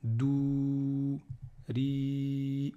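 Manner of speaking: "Dou", "ri" with vowels held long and level; chant-like held vowels on "Dou", "ri". Shown as a demonstration. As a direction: stretch the ou of "Dou" and i of "ri". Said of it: "les voyelles longues".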